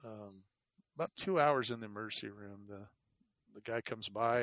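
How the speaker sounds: ordinary delivery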